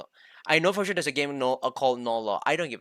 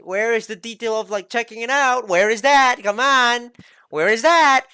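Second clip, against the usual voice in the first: frustrated yelling; 8 semitones higher, 7 dB louder than usual. Real, not acted. Frustrated ranting at menu screen.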